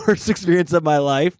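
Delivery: ordinary talk, nothing marked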